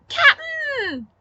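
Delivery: put-on child's shriek. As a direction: shriek it across the cave like a small girl. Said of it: "A high-pitched shriek".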